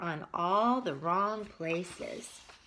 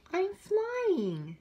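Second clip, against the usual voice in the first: much higher, louder joyful cry; exclaimed; 11 semitones higher, 5 dB louder than usual. Acted. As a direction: burst out in delight. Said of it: "Bear exclaimed".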